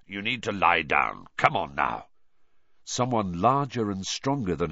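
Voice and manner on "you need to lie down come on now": low coaxing man. A narrator's reading. Man urging Harry along.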